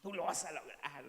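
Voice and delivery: put-on cheerleader voice; motivational pep talk. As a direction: egg them on, hammed-up. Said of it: "animándote, haciendo un coco wash".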